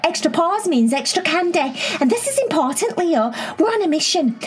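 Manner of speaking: put-on bubbly, high-pitched little girl; excited high girl voice. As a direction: squeal it out, eager and excited. Cherry voiced in a story.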